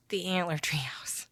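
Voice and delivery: hushed whispered aside. Real, not acted. Vlogger joking to viewers about the treehouse name, whispered. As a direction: let the name slip out in a whisper.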